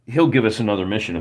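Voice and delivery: ordinary talk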